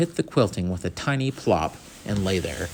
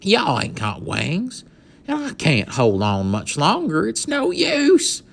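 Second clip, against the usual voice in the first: despairing cowboy; 8 semitones higher, slower than usual. Acted. Boone despairing on the beam.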